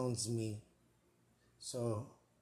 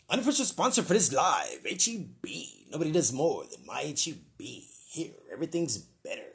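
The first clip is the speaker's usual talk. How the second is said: upbeat sponsor plug, louder; ad read; 3 semitones higher, 4 dB louder than usual. Shown as a demonstration. Host doing a mock sponsor read. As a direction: read it like a radio ad. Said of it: "I have to do an unofficial sponsor".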